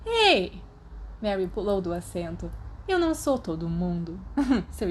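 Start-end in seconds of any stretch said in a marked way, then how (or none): 0.1-0.6 high, loud indignant ei; sharp offended shout. Acted. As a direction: protest at being lumped in. Mary protests.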